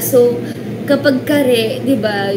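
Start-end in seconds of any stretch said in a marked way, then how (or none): 1.2-1.8 Kare said ka-re, with Tagalog vowels; Kare, two syllables, very Tagalog; Tagalog pronunciation. Shown as a demonstration. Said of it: "napakatagalog nun".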